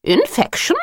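high, sharp startled question. Acted. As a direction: jump at the word in alarm. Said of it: "looking startled".

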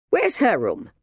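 curt strict minder; stern governess. Acted. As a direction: demand it briskly. Minder asking.

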